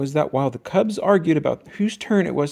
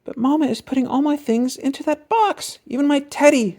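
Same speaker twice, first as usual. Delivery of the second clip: put-on much higher little girl voice; Sister complaining; 12 semitones higher than usual. A narrator's reading. Sister complaining to Papa about the box, upset. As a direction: tattle on Mama, upset. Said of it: "said Sister".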